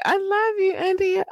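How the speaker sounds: quick high-pitched cutesy coo; falsetto shout-out. Pretend. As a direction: jump way up high for the love line.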